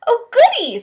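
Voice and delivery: thrilled little bear; delighted. A narrator's reading. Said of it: "thought Bear".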